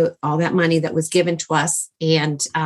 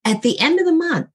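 earnest explanation; 10 semitones higher than usual. Retold or imitated. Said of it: "He goes".

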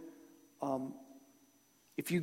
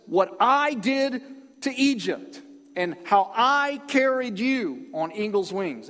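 "I" hit high and loud, slow delivery; emphatic, drawn-out "I did" and "I carried"; stress on I; 3 semitones higher, 4 dB louder, slower than usual. Acted. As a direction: quote God slowly, punching each "I". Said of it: "Do you see where the emphasis is?".

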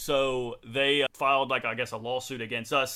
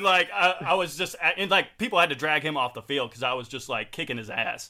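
normal talking voice

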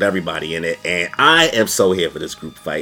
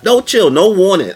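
hyped; 10 semitones higher, 7 dB louder than usual. Real, not acted. Hyped commentary.

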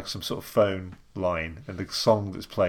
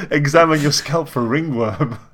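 advert voice; 5 semitones higher, 6 dB louder than usual. Retold or imitated. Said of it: "the payoff line".

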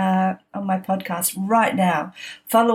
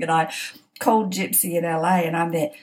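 ordinary delivery

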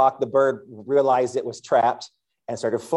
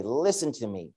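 soft, tender invitation; much softer, tender voice of the Lord; the Lord's gentle call; 3 semitones lower, 7 dB quieter than usual. Retold or imitated. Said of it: "He's saying".

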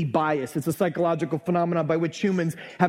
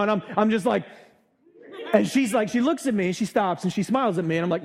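normal talking voice

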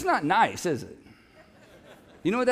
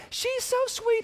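gushing admirer; 14 semitones higher than usual. Retold or imitated. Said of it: "Everybody's like".

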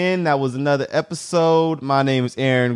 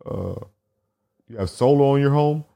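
lower, hesitant solar-sales pitch; telemarketer Josh; 5 semitones lower than usual. Retold or imitated. Josh cold-calling about solar, hesitant low voice. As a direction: fumble through a sales opener. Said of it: "He goes like".

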